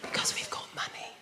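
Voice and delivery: hushed whisper, voice dropped right away; mock posh whisper. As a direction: whisper it like a posh low talker. Mocking quiet middle-class talkers.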